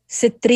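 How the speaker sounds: street said as "si-treet"; every-letter street. Shown as a demonstration. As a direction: split the S from street with a vowel, "si-treet". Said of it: "you pronounce every letter".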